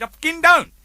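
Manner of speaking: high, loud retort; acted exasperated retort. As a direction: snap back in mock exasperation. Retold exasperated reply to customs.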